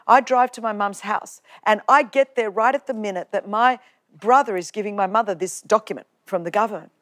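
normal talking voice

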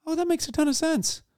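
high, airy, unbothered reply; breezy, no-big-deal tone; easygoing reassurance. Retold or imitated. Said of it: "because I'm like".

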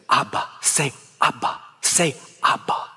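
whispered, tender coaxing; whispering to a baby. Retold or imitated. Father coaxing his baby over the crib, whispered. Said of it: "a father would stand over the crib".

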